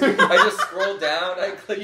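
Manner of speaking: slow, laughing admission. Real, not acted. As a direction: chuckle through the words. Laughing while confessing.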